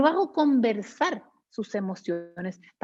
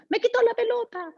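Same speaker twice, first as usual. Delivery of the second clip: lightly voiced small child, higher; a hint of a hurt little boy; 10 semitones higher than usual. Speaker voicing a child telling his mother who took his ball, in a lightly higher child voice.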